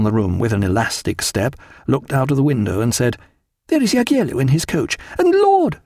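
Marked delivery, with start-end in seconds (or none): 3.7-5.8 high, rising exclamation; excited cry. A narrator's reading. Wife spotting visitors from the window, high excited cry. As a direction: cry out, pitch climbing sharply. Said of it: "she cried".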